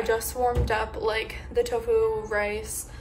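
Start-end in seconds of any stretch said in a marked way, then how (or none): none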